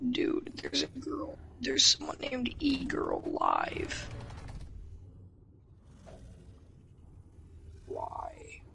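raspy, mostly voiceless voice; tired late-night rasp; hoarse whisper. Real, not acted.

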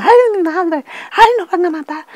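high, loud cry of dread; re-enacted panic. Woman fearing they will be killed, re-enacted panicked cry. Retells her terror during the massacre.

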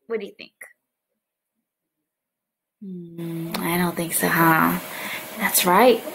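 ordinary talk, nothing marked

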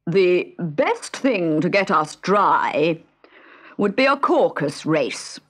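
slow, pompous bird voice; a grand, drawn-out Dodo. A narrator's reading. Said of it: "he said".

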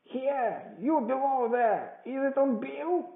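high, loud hailing question; crying out. A narrator's reading. Blind man hailing the man below, demanding shout. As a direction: holler high to someone downstairs. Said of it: "cried the blind man".

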